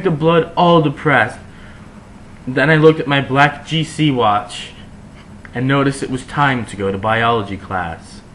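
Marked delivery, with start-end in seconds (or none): none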